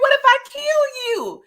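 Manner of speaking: high laughing mock threat; giggly, high retelling of the threat. Vlogger retelling the woman's threat, laughing.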